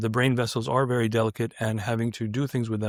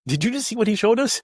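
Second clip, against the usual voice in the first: higher-pitched disbelief; incredulous aside; 9 semitones higher than usual. Retold or imitated. Turning to his colleague at the talk, incredulous. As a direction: ask it high, like you can't believe it.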